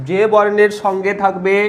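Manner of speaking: slow, measured phrases with long pauses; teacher's dictation. Acted. Teacher giving students rule two to write, slow dictation. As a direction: dictate it piece by piece, repeating phrases.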